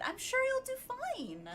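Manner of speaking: high, bright peppy encouragement. Shown as a demonstration. Streamer demoing the peppy read for the game line: high peppy cheer. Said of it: "you gotta be peppy with it".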